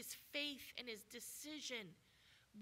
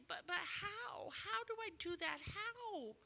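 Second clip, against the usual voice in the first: doubting invalid; 10 semitones higher, 4 dB louder than usual. Acted. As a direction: whine it out like a man who can't see how. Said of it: "He didn't say".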